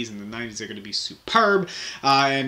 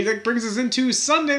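ordinary talk, nothing marked

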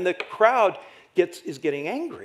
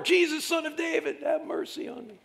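crying out all the more; 13 semitones higher, 3 dB quieter than usual. Acted. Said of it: "Cried out all the more".

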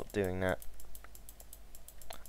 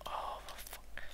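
whispered curse; 15 dB quieter, slower than usual. Real, not acted. Muttered frustration.

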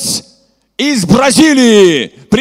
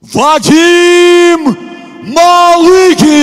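shouted name; 6 semitones higher, 4 dB louder, much slower than usual. Acted. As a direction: call the name out higher and loud. (выкрикнуть имя бойца)

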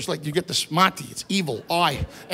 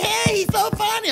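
mocking audience; 14 semitones higher than usual. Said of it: "they're all like".

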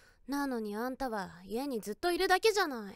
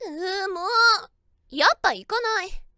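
flustered whine; 4 semitones higher, 10 dB louder than usual. Acted. Flustered whiny outburst.

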